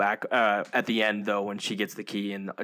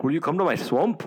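higher, put-on Scottish Shrek voice; Shrek accent; 7 semitones higher than usual. Podcaster jokingly voicing Shrek to his friends, hammed-up Scottish accent. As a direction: ham up the ogre's Scottish brogue. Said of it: "trying to do the Shrek accent".

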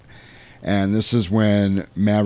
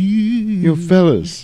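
high, cocky goofball greeting; higher put-on voice calling to the guys; goofy put-on voice; 10 semitones higher, 3 dB louder, much slower than usual. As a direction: toss it off like a cocky show-off. Putting on a goofy voice.

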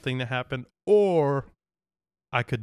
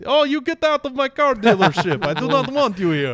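put-on much higher, louder Russian accent on request; 11 semitones higher, 6 dB louder than usual. Friend asks for the accent again, loud hammy Russian accent. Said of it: "that was kinda like Russian".

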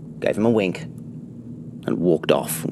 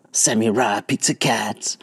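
whispering; 3 semitones higher than usual. Acted. Repeat whispered.

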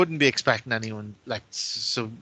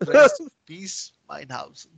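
put-on German accent; 4 dB louder than usual. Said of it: "he does the whole thing with a German accent".

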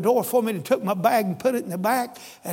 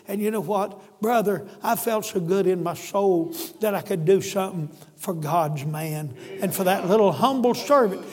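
normal talking voice

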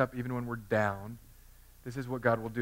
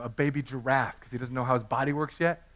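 normal talking voice

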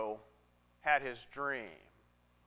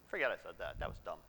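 much lower and softer, brushing it off; muttered dismissal; 9 semitones lower, 10 dB quieter, faster than usual. Acted. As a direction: drop it low and quiet as if embarrassed. Dismissing his own idea.

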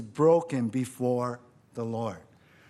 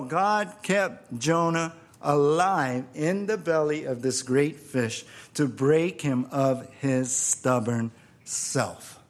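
ordinary delivery

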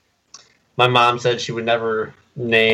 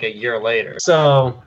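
ordinary delivery

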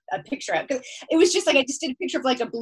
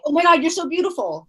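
high, loud breathless gush; overwhelmed by how beautiful she is; gushing awe; 6 semitones higher, 5 dB louder, faster than usual. Retold or imitated. Tripping friend gushing at her pal, awestruck.

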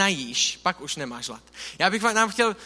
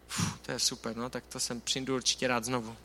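sarcastic; 6 semitones lower, 7 dB quieter than usual. Retold or imitated. Sarcastic reaction to the church.

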